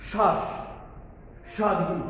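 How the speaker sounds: শ্বাস and স্বাধীন with a breathy push on the first sound; initial stress. Shown as a demonstration. Teacher demonstrating শ্বাস with a stressed start. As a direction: hit the opening of শ্বাস like an aspirated consonant. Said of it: "এই যে প্রথমে একটা ঝোঁক দিচ্ছি".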